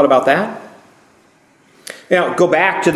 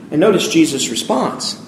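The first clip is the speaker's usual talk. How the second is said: ordinary talk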